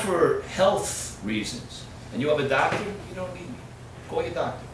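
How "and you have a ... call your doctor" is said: ordinary delivery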